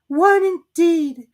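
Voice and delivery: high, slow squeaky huff; indignant Dormouse. A narrator's reading. Dormouse offended by Alice, indignant. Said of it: "said the Dormouse indignantly".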